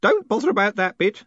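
put-on high, loud, impatient old postman; excited. A narrator's reading. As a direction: cut in excitedly, pitch way up. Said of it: "said Groat excitedly".

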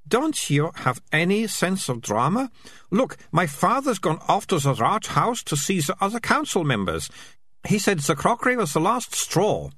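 lightly voiced know-it-all girl; bossy girl voice. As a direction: play the bossy young girl. Melicia voiced high.

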